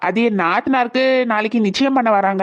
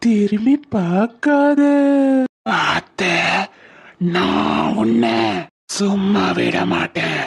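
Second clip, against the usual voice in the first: very slow, drawn-out spectral threat; low, eerie haunting voice; menacing ghost; 4 semitones lower, much slower than usual. Acted. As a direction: stretch each word out menacingly.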